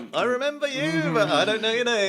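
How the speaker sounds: high, sheepish mock apology; awkward self. Guest mimicking his awkward reply to a fan, high and sheepish. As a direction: play your own embarrassed reply. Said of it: "I'm like".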